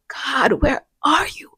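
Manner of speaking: soft, breathy whispered cry; whispered plea. Retold or imitated. Alone, still asking God where he is, hushed whisper. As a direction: whisper it like a broken prayer. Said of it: "I still be like".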